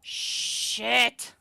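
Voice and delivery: loud, drawn-out swear; frustrated curse. Real, not acted. Player cursing at the card game, loud frustration.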